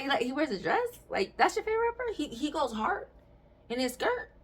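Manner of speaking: high, fast incredulous questioning; mock disbelief. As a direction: pitch up in disbelief at him. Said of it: "I was like".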